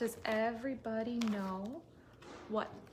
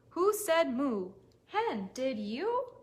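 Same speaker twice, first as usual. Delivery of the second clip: curious question; 3 semitones higher, 4 dB louder, much slower than usual. Acted. Storyteller questions animals.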